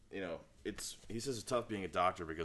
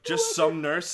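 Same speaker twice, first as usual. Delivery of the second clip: high, slow and loud, dragged out; lazily name any nurse; mock offhand doctor; 7 semitones higher, 12 dB louder, much slower than usual. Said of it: "and then he goes like".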